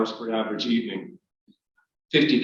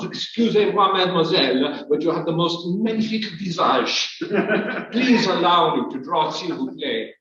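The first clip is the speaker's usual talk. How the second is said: higher, hammed-up fake French accent with laughter; miserable French accent; 5 semitones higher than usual. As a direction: ham up a bad French accent, laughing through it. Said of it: "saying in my miserable French accent".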